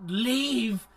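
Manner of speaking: high, loud, very slow screech; hammed-up Gollum screech; Smeagol voice. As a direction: rasp it out like Gollum. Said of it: "Like Smeagol".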